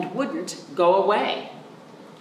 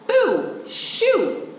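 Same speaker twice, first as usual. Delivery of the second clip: high, loud boo shoo; 14 semitones higher, 3 dB louder than usual. Acted. Child shooing the possum, high and loud. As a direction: shout it off the porch.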